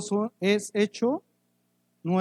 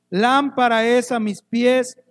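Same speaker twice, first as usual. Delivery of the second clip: reciting scripture; 3 semitones higher, 7 dB louder than usual. Acted. Recites a Bible verse.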